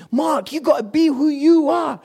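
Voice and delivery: usual speaking voice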